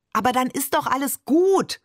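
high-pitched indignant protest; child flaring up, moderately acted; almost as heated. A narrator's reading. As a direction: burst out in objection. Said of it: "fast genauso aufbrausend".

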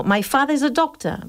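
"My father is a doctor" said the English way; English accent. Shown as a demonstration. Teacher demos an English speaker saying "My father is a doctor" in English accent. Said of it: "dirían los ingleses".

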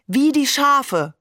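high, drawn-out mock cry; amused singling-out shout; hoarse giggling call. A narrator's reading. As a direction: call out slowly, pitched right up. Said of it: "rief Hamed heiser kichernd".

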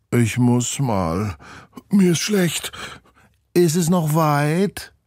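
put-on high, slow whining child voice; whiny child. Children on the bus complaining to the teachers, whining. Carsick kids whine on bus.

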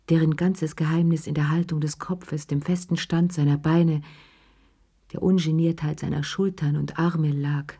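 plain reading voice

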